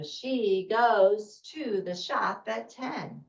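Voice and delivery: smooth, slightly slow, expressive reading; fluent sentence after practice; fluent read-aloud. Shown as a demonstration. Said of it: "And so we go".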